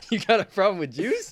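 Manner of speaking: loud, high jokey challenge; mock accusation. Friend jokingly accusing cohost, loud and high. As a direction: call him out in jest.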